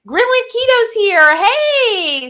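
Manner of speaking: high, loud happy hello; excited greeting. Real, not acted. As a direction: greet a viewer with glee. Greeting a viewer arriving.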